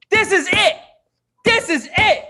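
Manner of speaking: high, loud, laughing hype; excited. Real, not acted. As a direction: shout it up in glee. Host hypes final.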